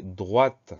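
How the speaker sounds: "droite" with the final T sounded; T pronounced. Shown as a demonstration. Teacher dictating the feminine form, final T pronounced in "droite". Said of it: "on entend ce T muet".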